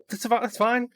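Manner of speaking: breezy high dismissive quip. Retold or imitated.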